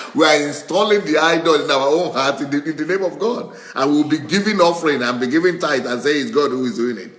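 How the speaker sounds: quick fervent preacher's jab; excited preaching. Real, not acted. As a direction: drive the point home with rising heat. Preacher mocking religious hypocrisy.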